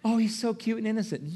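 high, soft mock cooing; doting admirer. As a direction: coo over a baby in a put-on sweet voice. Voicing doting admirers of his son.

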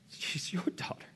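breathy whispered answer; a hushed reply to God; whispered surrender. Retold or imitated. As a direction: give it up in a whisper.

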